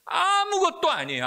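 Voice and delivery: high, loud cry of nothing at all; shouted declaration. Real, not acted. Preacher crying out to parents, loud shout. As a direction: hurl it out with full force.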